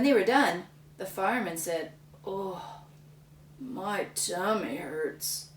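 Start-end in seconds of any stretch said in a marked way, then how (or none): 2.3-5.5 slow, soft groaning complaint; tummy ache. Acted. Lumberjack groaning after eating too much, soft and slow. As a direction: moan it weakly. Sick fireman.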